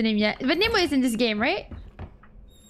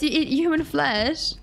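normal talking voice

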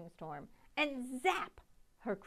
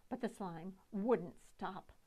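ordinary delivery

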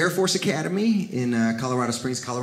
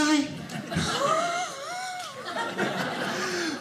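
high, fading mock laugh; high laughing shriek; 8 dB quieter than usual. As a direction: burst into a shrill cackle. Dad laughing at door.

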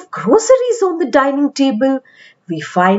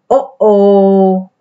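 lower, drawn-out knowing uh-oh; 3 semitones lower, 4 dB louder than usual. Acted. Child sighing at Mummy losing her phone. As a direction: groan it like here we go again.

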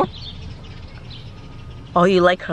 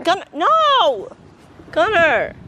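scolding call; 7 semitones higher than usual. Real, not acted. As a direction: stop the dog mid-chase. Dog chasing birds.

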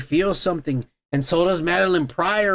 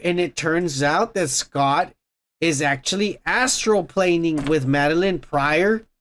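ordinary talk, nothing marked

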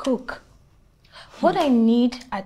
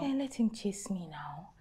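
whispered aside; 11 dB quieter than usual. Acted. Dismissive aside to friend.

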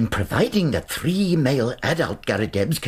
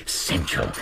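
whispered; 8 semitones lower, 3 dB quieter than usual. Acted. Muttering over his fossils.

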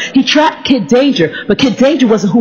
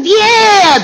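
high, drawn-out "yeah"; Tyler; 10 semitones higher, 3 dB louder than usual. Retold or imitated. Preacher showing how Tyler talks, high put-on voice. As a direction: imitate a boy's changing voice, pitched way up. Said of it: "that's how he talks".

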